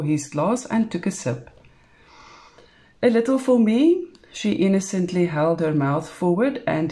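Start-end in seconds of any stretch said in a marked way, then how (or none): 3.0-4.1 high, coaxing little voice; coy request. A narrator's reading. Woman coaxes her lover for a sip, coy. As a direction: ask sweetly and innocently.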